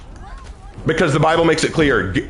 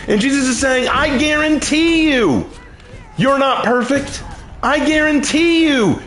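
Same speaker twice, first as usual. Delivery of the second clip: raised pitch, emphatic sermon delivery; impassioned preaching; 7 semitones higher than usual. Real, not acted. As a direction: preach it hard, landing each point. Preaches with acted quotes.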